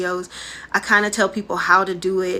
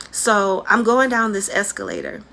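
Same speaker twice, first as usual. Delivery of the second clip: ordinary talk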